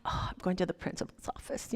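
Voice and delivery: whispered worried aside, fast; nervous whisper. Retold or imitated. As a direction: whisper it like a kid in trouble. Nervous thought when called over.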